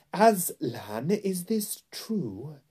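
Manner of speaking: very slow, high imperious queen; menacing royal interrogation; crying out, then threatening. A narrator's reading. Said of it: "cried the Queen".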